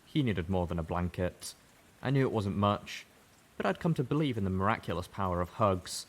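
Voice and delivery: plain reading voice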